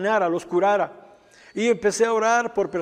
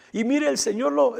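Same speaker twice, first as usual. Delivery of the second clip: usual speaking voice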